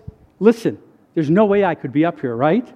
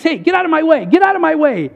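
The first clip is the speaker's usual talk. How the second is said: higher-pitched, playful urgency; mock-clear a path through a crowd; acted eager rush to get past; pushing through eagerly; 7 semitones higher than usual. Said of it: "gonna be like this".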